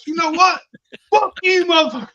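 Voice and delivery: high, loud mock yell, laughing into it; hammed-up angry shout with a laugh; retold drunk outburst. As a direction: imitate yourself going off at him. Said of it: "I started going the fuck off".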